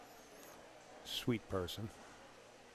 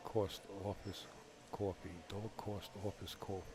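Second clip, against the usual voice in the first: Bronx accent; 9 dB quieter than usual. Shown as a demonstration. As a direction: quietly savor each word in thick Bronx. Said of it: "That's a certified Bronx accent".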